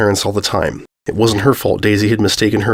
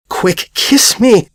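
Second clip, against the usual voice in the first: high, drawn-out panicked urging; desperate to put on a show right now, slow and high; filly panicking as her mother approaches; frantic plea; 11 semitones higher, much slower than usual. Panicked plea before staged kiss.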